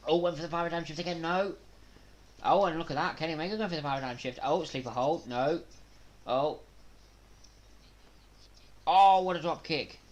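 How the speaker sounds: fast, high, excited ringside calling; live play-by-play. Real, not acted. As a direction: keep up with every move.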